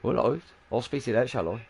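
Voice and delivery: dad giving in. Retold or imitated. Dad answering his upset daughter, mildly placating. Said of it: "And I was like".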